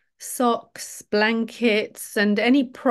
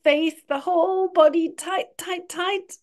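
tense up along with the listeners, higher-pitched; urging tightness; 6 semitones higher than usual. Acted. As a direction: pitch climbing as the tension builds. Urging whole body to tense.

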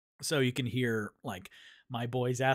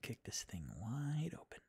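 whispering; 2 semitones lower, 11 dB quieter than usual. Real, not acted. Aside to self.

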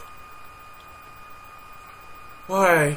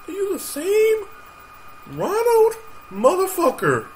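incredulous, voice way up high; high-pitched disbelief; 4 dB louder than usual. Real, not acted. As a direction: squeal the question in high, drawn-out disbelief. Incredulous reaction to the game character.

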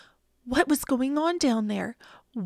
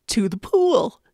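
yelled; 2 semitones lower, 7 dB louder than usual. A narrator's reading. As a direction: shout the order without raising the pitch. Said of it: "yelled the firemen".

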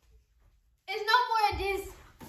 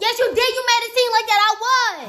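loud, higher-pitched protest; heated accusation; 7 semitones higher, 7 dB louder than usual. Real, not acted. Girlfriend insisting he misled her, heated and loud. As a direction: throw the blame back loudly. Arguing over who misled whom.

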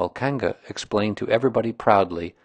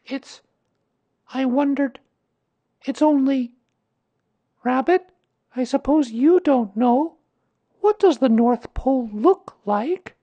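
put-on high, hesitant boy's voice; halting child asking an awkward question; pitched-up young boy, feeling his way; Christopher Robin; 8 dB louder than usual. A narrator's reading. Christopher Robin hesitantly asks Rabbit.

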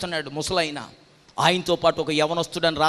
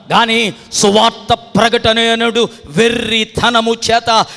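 loud, drawn-out proclamation rising to a held hallelujah; impassioned pulpit declamation, sustained and forceful; full-force preaching; 5 semitones higher, 11 dB louder, slower than usual. Real, not acted. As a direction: thunder it out to the congregation, stretching key words.

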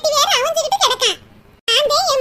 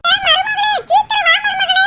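high, loud laughing shout; mother-in-law hollering for her daughter-in-law; 4 semitones higher, 4 dB louder than usual. Acted.

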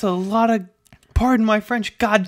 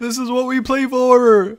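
Charlie's victory yell; 7 semitones higher, 8 dB louder than usual. Acted. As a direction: imitate a triumphant clip at full voice. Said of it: "that one clip of Charlie going".

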